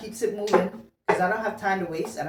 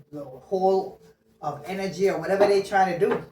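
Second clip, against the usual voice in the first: ordinary talk, nothing marked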